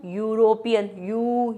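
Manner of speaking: यूरोपियन cut back to यू, "yoo"; sounding out onset. Shown as a demonstration. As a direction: isolate the y-glide starting यूरोपियन. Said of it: "य की sound आ रही है".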